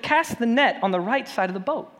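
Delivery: calling out. Retold or imitated. Jesus calling instruction.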